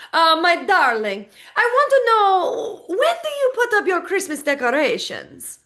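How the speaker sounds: loud Italian diva, hammed up; Bruno Tonioli accent. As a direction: sing out a flamboyant fake Italian accent. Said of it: "my inaugural Bruno Tonioli accent".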